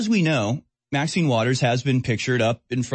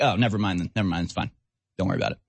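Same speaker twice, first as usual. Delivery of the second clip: mock dismissive; 5 semitones lower, much faster than usual. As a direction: shrug it away, trailing lower. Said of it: "And they're like".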